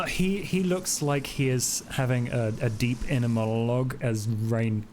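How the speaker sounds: normal talking voice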